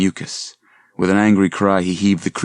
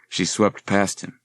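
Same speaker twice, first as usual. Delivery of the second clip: plain reading voice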